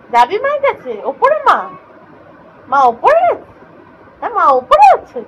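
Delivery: high, teasing questions to a pet; squeaky-high eager coaxing with swooping questions; excited pet talk. Real, not acted. Exciting pet about going to mother.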